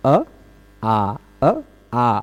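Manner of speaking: अ said short with a small mouth opening, then आ long and open; short and long a contrast. Shown as a demonstration. Teacher demonstrating अ and आ to students, contrasting them. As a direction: alternate अ and आ to show the difference in vowel length. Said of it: "अ बोलने में कम मुंह खुलता है".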